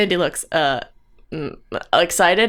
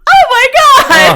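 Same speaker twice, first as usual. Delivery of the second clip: high, loud, drawn-out squeal; overjoyed cry; 13 dB louder, slower than usual. Acted. Player's character scoops up her brother, squealing. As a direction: wail it high in joyful shock. Acted reunion with long-lost brother.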